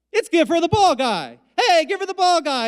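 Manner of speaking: high, bright-up recognizing shout; excited greeting. Retold or imitated. Hootie's guitarist recognizing the teller on the concourse, high excited greeting. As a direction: greet a famous stranger with delight.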